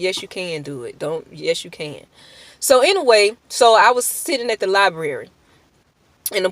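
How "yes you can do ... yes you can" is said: soft reassurance. Retold or imitated. God reassuring her, imagined voice.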